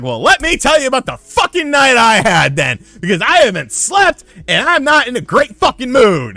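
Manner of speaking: high, loud angry retort; fed-up outburst. Retold or imitated. Sleepless worker to his bosses, fed-up shouting. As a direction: blow up as if retelling a terrible night. Retold blow-up at work.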